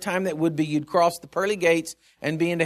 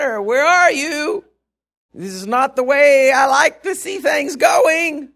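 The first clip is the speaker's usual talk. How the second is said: exasperated preaching shout; 8 semitones higher, 6 dB louder, slower than usual. Real, not acted. Complaining to God, preaching.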